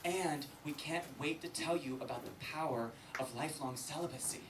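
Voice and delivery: soft, gentle imitation of a priest; hushed, mock-pastoral encouragement; priest's voice. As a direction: confide it quietly, like kindly clergy. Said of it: "Jesuit priest came up to me".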